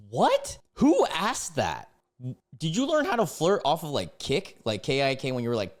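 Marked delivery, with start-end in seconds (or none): 0.1-3.8 appalled, higher than usual; incredulous. Real, not acted. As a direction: balk at the question, pitch jumping up. Reacting to a creepy message question.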